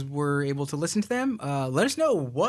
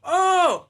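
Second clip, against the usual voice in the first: imitated gasp; 14 semitones higher, 5 dB louder than usual. Vanessa reacts to news.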